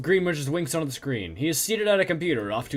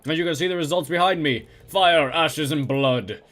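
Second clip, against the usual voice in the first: loud, punchy TV reporter; news anchor voice; 4 dB louder than usual. Acted. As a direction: read it like breaking news on air.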